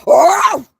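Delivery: a sharp loud arf shouted right back; imitated dog bark, shouted. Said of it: "and I went".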